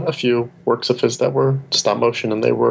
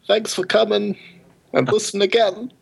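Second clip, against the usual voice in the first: higher, halting stab at Walken; second try at a Walken voice; lightly voiced Walken with choppy pauses; Christopher Walken impression; 8 semitones higher than usual. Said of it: "Would you like to try again?".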